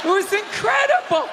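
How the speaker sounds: a quarterback's wife bragging about his throws; mock bragging wife. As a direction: brag like you earned the spot.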